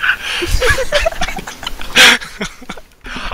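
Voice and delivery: high squealing burst of laughter; shrieking laugh. Real, not acted. Pranksters crack up after hanging up, squealing laughter.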